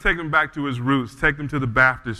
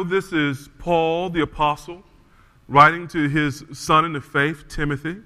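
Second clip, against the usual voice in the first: ordinary talk, nothing marked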